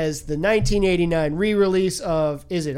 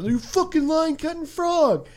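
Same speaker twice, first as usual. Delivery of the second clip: higher, sneering soldier voice; nasty soldier; 9 semitones higher than usual. Retold or imitated. A soldier insulting the Frenchman, mock sneering. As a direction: spit it out like a mean soldier. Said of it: "super shitty about it".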